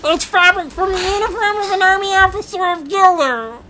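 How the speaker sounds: very high, loud character voice; piercingly high put-on movie villain; Vizzini. Shown as a demonstration. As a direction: belt it out in a hammed-up squeal. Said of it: "Do that voice again".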